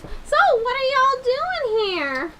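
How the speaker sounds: high, slow friendly woman; lightly voiced curious lady. GM voicing lady NPC.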